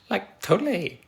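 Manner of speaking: high, mock Valley Girl catchphrase; Valley Girl accent. Streamer repeating Lindsay's suggestion, high mock Valley Girl. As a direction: try a hammed-up Valley Girl, voice up. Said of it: "that's a good thing to say".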